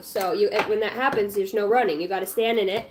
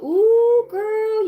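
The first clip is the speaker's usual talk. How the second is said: excited squeal; 11 semitones higher, 5 dB louder than usual. Real, not acted. Excited reaction to tarot card.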